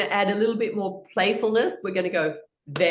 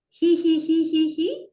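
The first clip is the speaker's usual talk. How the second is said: high, loud hee hee; high hee laugh; 10 semitones higher, 4 dB louder than usual. Shown as a demonstration. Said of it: "hand on the throat".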